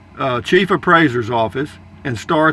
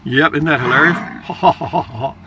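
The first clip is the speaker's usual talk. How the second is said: slow, drawn-out mock appreciation; dry sarcasm; slower than usual. Real, not acted. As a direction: pretend the lame joke is hilarious, taking it slowly. Mock praise of assistant's joke.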